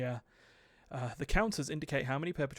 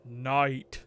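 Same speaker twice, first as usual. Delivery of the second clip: louder B-movie title read; stilted old movie melodrama; 7 dB louder than usual. Pretend. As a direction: announce it like an old horror film. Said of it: "B-movie style".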